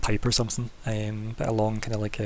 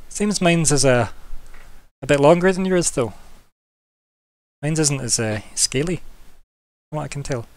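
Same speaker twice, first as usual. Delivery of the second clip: smug boast; 4 semitones higher than usual. Acted. Kurt boasting about his tail.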